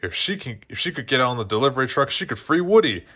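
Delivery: ordinary delivery, nothing marked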